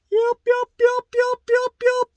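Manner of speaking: very high, loud, repeated "yelp" like a bird; mimicked turkey yelp. Shown as a demonstration. Teacher showing jaw movement in a yelp, loud falsetto turkey mimicry. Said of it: "as a yelp".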